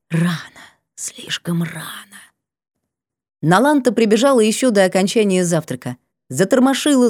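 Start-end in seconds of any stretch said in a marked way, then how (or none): 0.1-2.1 breathy, slow vexed whisper; last fading breath, annoyed; whisper with regret. Acted. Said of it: "последним выдохом с досадой".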